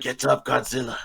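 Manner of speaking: lightly voiced movie mentor; low urging line, lightly acted; Ken Watanabe. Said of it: "Ken Watanabe just appears in front".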